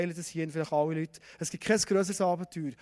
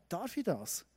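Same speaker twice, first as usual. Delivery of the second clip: normal talking voice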